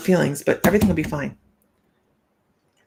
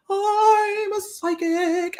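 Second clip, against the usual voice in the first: higher, slow, sing-song mock proclamation; mock announcement; 11 semitones higher, much slower than usual. To strangers on the street, a mock grand announcement. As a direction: play an over-the-top self-introduction. Mocks announcing her job in public.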